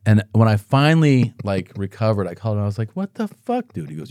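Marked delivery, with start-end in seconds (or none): 2.9-3.9 high-pitched disbelieving mock protest; indignant retold outburst. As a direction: act out a baffled, indignant complaint. Retells angry phone call.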